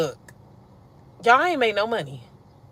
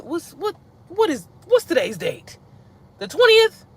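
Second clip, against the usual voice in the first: shocked question; 8 semitones higher, slower than usual. Real, not acted. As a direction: gasp out the date. Shocked at the date.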